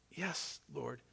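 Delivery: a disciple answering under his breath; quiet, ashamed yes; hushed reply. Acted. Peter answers Jesus again.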